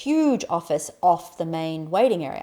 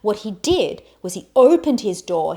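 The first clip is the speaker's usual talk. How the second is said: normal talking voice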